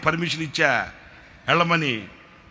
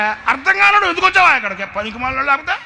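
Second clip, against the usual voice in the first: higher, louder furious rant; angry scolding; 11 semitones higher, 7 dB louder than usual. Retold or imitated. Berating the useless friend, angry shout. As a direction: keep berating him.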